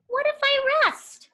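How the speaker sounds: a high, limp storybook voice; high floppy voice. Acted. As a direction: float it up high like a deflated toy. Floppy character line.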